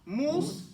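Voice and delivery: Mus with a clear stop after it; with a pause. Shown as a demonstration. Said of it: "Take the pause".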